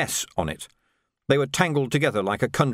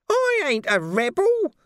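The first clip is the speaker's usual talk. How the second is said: high, slow indignant cry; shouted; 3 dB louder, slower than usual. A narrator's reading. A touch of Nobby shouting his protest at the Major. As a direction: howl it in protest. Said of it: "Nobby shouted".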